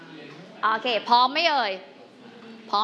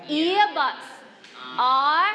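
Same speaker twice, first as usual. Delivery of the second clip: slow, stretched words with gaps between; drawn-out choral lead; 2 semitones higher, much slower than usual. Shown as a demonstration. Teacher counts the class in to read, very slow and measured. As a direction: lead a class reading word by word.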